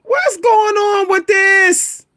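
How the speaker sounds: high, drawn-out bewildered question; baffled disbelief. Real, not acted. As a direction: stretch it out high, truly baffled. Baffled reaction to the building.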